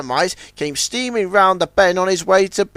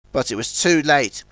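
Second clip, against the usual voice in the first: ordinary delivery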